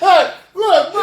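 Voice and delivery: high, loud laughing exclaim; laughing loudly through the words. Retold or imitated. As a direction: burst out laughing mid-quote. Laughing retelling his reaction.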